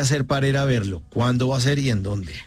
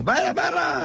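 high mock shout in an Ibagué accent; slower than usual. Man shouting in the background with an Ibagué accent. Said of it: "con ese acento ibaguereño".

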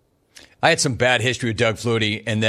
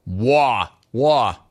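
exaggerated Brooklyn vowel on "war"; mock New York accent. Host mimics the New York caller's "war", mock Brooklyn accent. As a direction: imitate a New Yorker saying "war". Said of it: "Bro, are you from New York?".

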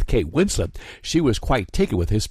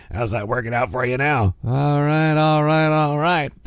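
Matthew McConaughey impression; 4 semitones higher than usual. DJ imitating Matthew McConaughey after the Titanic story, drawling. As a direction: slide into a laid-back Southern movie-star voice. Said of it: "I think Matthew's gonna be okay".

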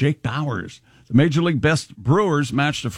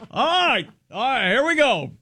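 mock rally cheer; 6 semitones higher than usual. Mariners bench players rally while losing.

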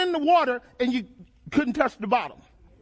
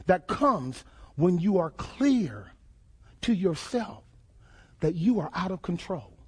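grave, deliberate explanation; 6 semitones lower, 3 dB quieter than usual. Real, not acted. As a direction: spell it out heavily, phrase by phrase. Weighty definition of depression.

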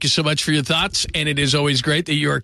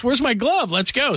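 suddenly eager, pitched up high; eager yes; 10 semitones higher than usual. Retold or imitated. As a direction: jump at the chance. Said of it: "goes to San Francisco and goes".

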